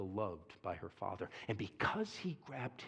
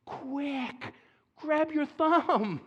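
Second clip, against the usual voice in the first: high, loud, urgent yell; daughter yelling; 8 dB louder, much slower than usual. Retold or imitated. Daughter yells to dad after crashing car, panicked shout. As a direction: shout it in a panic, stretched slow. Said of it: "and yells".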